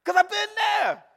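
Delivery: high, loud shouted preaching with laughter; preaching at full force. Real, not acted. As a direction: whip the congregation up to the climax. Preaching climax.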